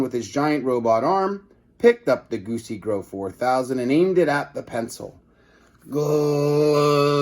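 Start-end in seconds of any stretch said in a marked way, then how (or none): 5.9-7.2 sing-song ray gun zap; sung zap sound. A narrator's reading. Narrator voicing the Goosey Grow firing.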